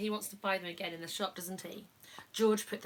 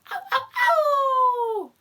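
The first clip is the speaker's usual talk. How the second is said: high, slow, loud drawn-out howl; dog howling; 13 dB louder, slower than usual. Pretend. Parent howling as the dog in the story. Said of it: "a dog who was howling".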